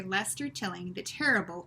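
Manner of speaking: ordinary delivery, nothing marked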